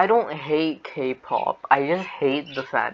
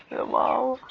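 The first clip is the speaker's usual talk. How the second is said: high sarcastic wow; mock wow; 11 semitones higher than usual. Real, not acted. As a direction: fake being impressed. Mock amazement at phrase.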